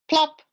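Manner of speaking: high, loud plop; sound-effect plop. A narrator's reading. Reading the drop falling, squeaky plop. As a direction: pop out the sound word.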